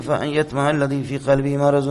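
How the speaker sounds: slow chanted Quran verse, stretched vowels; slow Quranic recitation. Shown as a demonstration. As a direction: intone the verse slowly, as in prayer.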